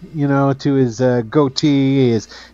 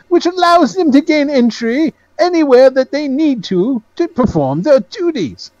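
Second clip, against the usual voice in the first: high-pitched, proud salesman; Penholt; 9 semitones higher, slower than usual. Acted. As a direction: lay out the plan proudly, voice lifted. Game master plays Penholt.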